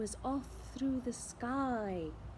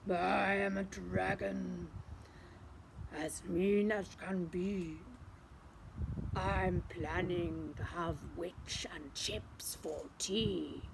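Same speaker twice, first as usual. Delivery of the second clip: low, quiet, menacing dragon; 5 semitones lower, 5 dB quieter than usual. Acted. Dragon threatening to eat the witch, low and menacing. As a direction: growl it low like a hungry dragon.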